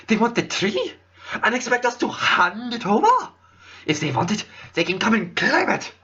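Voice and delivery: put-on a noble's accent drifting between British and French; wavering British-French accent. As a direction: play Sakuya with a British accent that keeps slipping into French. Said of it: "does my Sakuya voice keep switching between British and French?".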